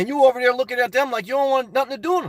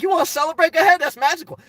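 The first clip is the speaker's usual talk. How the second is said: carefree guy; 7 semitones higher, 4 dB louder, faster than usual. Retold or imitated. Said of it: "I'm the type of guy".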